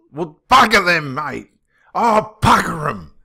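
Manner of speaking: loud defiance, shrugging off the family; loud defiant outburst. Real, not acted. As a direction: fling it off loudly.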